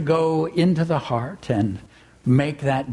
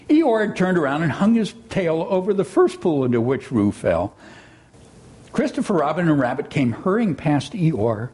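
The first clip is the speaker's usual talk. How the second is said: ordinary talk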